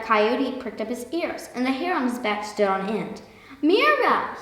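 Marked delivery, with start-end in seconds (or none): 3.6-4.3 high, loud startled yelp. A narrator's reading. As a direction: yelp out in alarm. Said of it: "he yelped".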